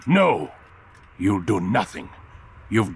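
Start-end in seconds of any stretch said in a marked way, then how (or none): 0.0-0.5 sharp angry no. Acted. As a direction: shut him down in one angry word. Master cuts Altair off.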